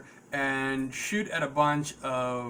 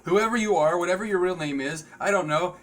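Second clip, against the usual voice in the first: loud, high winner announcement; excited announcement; 4 semitones higher, 4 dB louder than usual. Real, not acted. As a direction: call out the winner with energy.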